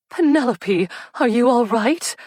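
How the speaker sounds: high, loud worried cry; alarmed cry. A narrator's reading. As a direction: call out in alarm to her cousin. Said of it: "Cousin Cordelia cried".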